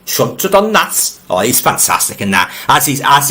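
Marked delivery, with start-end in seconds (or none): 0.1-1.2 higher, slower imitation in a Yorkshire accent; quoting a film actor's Yorkshire accent line, pitched up and drawn out; Peter Cushing Yorkshire accent. Host mimics a film actor's Yorkshire line, higher and slower. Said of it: "he says that in a Yorkshire accent".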